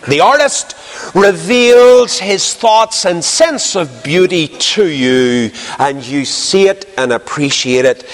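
slow, deliberate pulpit delivery; measured sermon cadence with long pauses; emphatic preaching. Real, not acted. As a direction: preach it slowly, stretching the key words. Preacher drives the illustration home.

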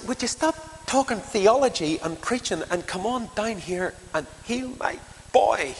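high, impatient pleading; exasperated plea. Acted. Father telling Jesus to stop preaching, high exasperated plea. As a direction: push past the lecture, exasperated. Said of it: "he's saying".

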